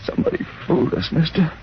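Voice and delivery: strained, half-voiceless last words; dying gasp. Acted. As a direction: force it out with failing breath. Fatally hurt man's last words.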